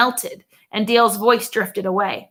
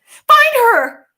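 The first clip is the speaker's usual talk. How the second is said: shout; 14 semitones higher, 9 dB louder than usual. A narrator's reading. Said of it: "I shouted".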